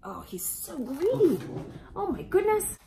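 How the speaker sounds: ordinary delivery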